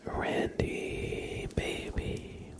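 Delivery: normal talking voice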